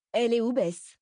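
unsure voice. A narrator's reading. Jenna asks Ruby where Bess is, unsure. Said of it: "demanda-t-elle d'une voix mal assurée".

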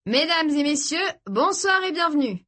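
high, grand showman welcome; playful compere voice; mock announcer. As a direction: greet them like a ringmaster. Alex greets the newcomers.

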